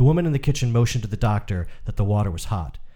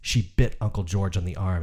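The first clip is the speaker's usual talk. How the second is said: ordinary delivery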